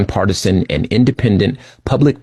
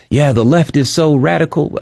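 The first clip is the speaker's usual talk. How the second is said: higher, lightly voiced mock of a smug critic; mocking right-winger; 8 semitones higher than usual. Host mimicking conservatives in those circles, mock higher voice. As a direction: parody the people who say this. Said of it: "these people who are like".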